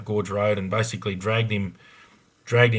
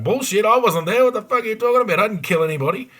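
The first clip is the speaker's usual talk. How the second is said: much higher, louder indignant outburst; furious innocent suspect shouting at the arresting cops; outraged denial; 12 semitones higher, 4 dB louder than usual. Retold or imitated. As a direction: explode in disbelief at being accused. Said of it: "I'd be there going".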